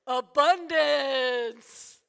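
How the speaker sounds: booming, hugely drawn-out proclamation; God's exultant proclamation. Acted. God answering worries over wasted apples, loud drawn-out proclamation. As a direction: belt it out loud, stretching every syllable. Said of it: "And God says".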